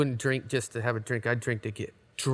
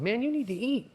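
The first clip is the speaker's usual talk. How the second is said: mock pleading friend; 10 semitones higher than usual. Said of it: "I'm like".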